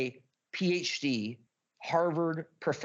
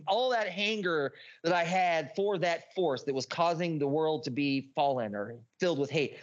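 ordinary delivery

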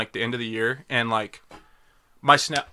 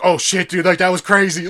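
loud, higher, laughing imitation; imitated excited reaction; 7 semitones higher, 7 dB louder than usual. Host quoting a friend's brother after an episode, excited imitation. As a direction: mimic an amazed fan through laughter.

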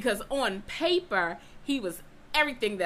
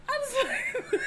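high squeal broken by laughter; laughing high; 3 dB louder, much slower than usual. Retold or imitated. Telling her date what she wanted, laughing and high.

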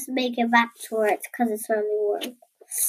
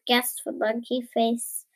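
plain reading voice

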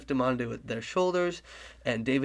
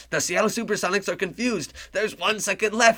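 higher, louder buzzer-beater call; excited announcer; 7 semitones higher, 4 dB louder than usual. Acted. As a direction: build the tension of the last second. Play-by-play, final second.

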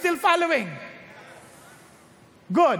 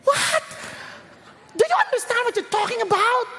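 sharp high "What?" then incredulous question; high, disbelieving challenge; disbelief; 7 semitones higher than usual. Real, not acted. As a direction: react as if you can't believe it. Preacher reacts in disbelief.

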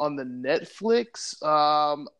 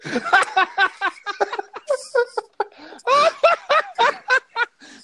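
loud high laugh; 5 dB louder than usual. Real, not acted. Big laugh.

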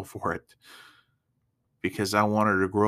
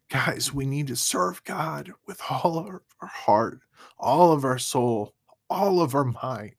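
fervent preaching; 6 semitones higher than usual. Real, not acted. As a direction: urge the listeners like a pastor at the pulpit. Preaches with rising fervor.

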